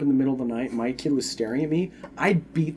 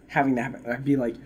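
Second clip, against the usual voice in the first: usual speaking voice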